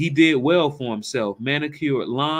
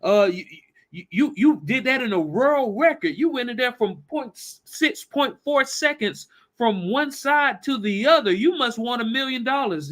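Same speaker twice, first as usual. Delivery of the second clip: high-pitched amazement at a record feat; acted excited disbelief; 8 semitones higher than usual. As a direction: stammer it out, astonished and much higher. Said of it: "excited, said".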